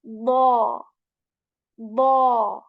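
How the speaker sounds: "Bo" loud, ending in an "aw" sound; bo with awe ending. Shown as a demonstration. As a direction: end "Bo" on an "aw". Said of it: "attach that sound at the end and say bo".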